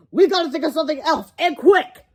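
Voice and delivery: put-on defiant kid refusing to quit; George's kid voice.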